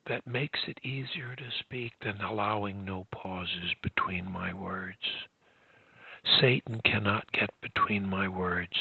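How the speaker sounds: low, soft, running words together; linked words. Shown as a demonstration. Coach demonstrating word linkage against stuttering, low and smooth. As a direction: join each word to the next, hushed. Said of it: "use word linkage".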